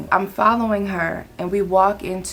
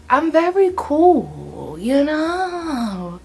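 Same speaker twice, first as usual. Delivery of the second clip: high, stretched-out sing-song; slow, lifted mock-cool delivery; playing it cool; 6 semitones higher, much slower than usual. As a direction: draw out the words in a playful voice. Shows own cool persona.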